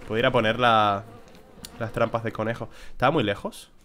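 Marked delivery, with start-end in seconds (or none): none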